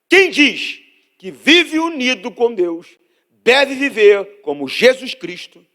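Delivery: slow, loud, high-pitched Bible reading; scripture proclaimed. Real, not acted. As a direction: proclaim the verse slowly to the congregation. (leitura bíblica proclamada, alta e pausada)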